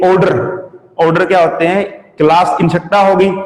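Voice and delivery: ordinary talk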